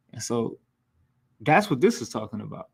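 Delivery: usual speaking voice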